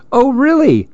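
very high, teasing mock surprise; joking. A narrator's reading. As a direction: tease with a high-pitched question. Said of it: "Mammy joked".

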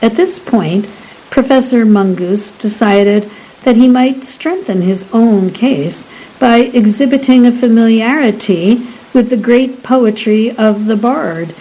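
plain reading voice

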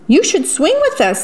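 high, quick singsong invitation; cheerful animal invitation. Acted. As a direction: coax a friend to join in, pitched high. Animals urging Jim to join.

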